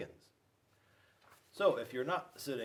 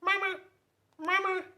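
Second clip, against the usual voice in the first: very high, loud gosling cries; baby geese calling; 8 dB louder than usual. Acted. Baby geese greeting Bruce, very high and loud. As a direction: squeak like a tiny gosling. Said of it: "all you heard was".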